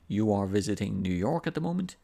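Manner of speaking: "You are visiting New York at the moment" as a rising question; same statement words, voice climbing through "at the moment"; intonation going up. Shown as a demonstration. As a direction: ask it by lifting the end on "moment". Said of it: "my intonation goes up".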